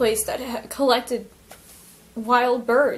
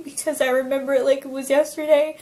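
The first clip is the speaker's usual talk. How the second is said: usual speaking voice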